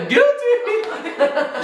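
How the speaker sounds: laughing. Real, not acted. Laughing admission.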